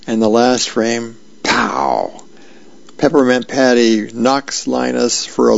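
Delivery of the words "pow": low, drawn-out pow; comic impact "pow". Acted. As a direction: voice a cartoon punch landing. Comic strip impact sound effect.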